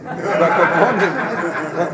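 real laughter breaking into the phrase; laughing. Real, not acted. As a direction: laugh out the words as you stretch them. Laughing while quoting the phrase.

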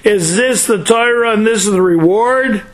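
retold plea, lightly anguished; crying out in protest. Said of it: "the angels cried to Hashem and say".

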